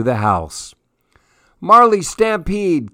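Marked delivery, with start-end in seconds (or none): 1.6-2.9 put-on high child's yell of warning; excited yell. A narrator's reading. Cassie warning everyone of the dog, high yell. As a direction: shout it like a kid charging in. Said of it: "Cassie yelled".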